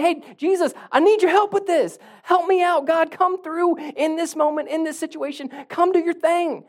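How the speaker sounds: loud, high, urgent plea; bold prayer. Acted. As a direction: call out to God boldly, full voice. Acts out bold prayer against timid.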